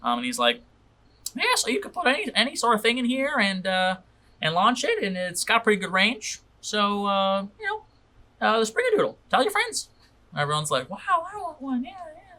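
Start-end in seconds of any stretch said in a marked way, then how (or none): 1.3-9.9 showman salesman. Acted. Salesman continues his pitch. 10.9-12.3 high, breathy gushing wow; eager crowd. Acted. Crowd wants the gadget.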